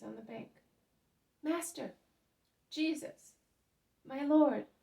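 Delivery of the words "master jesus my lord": loud, higher calls full of joy and veneration; three rising, joyful shouts of greeting; joyful cries. A narrator's reading. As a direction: cry out in joyous reverence to the master. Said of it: "The three cries of the disciple".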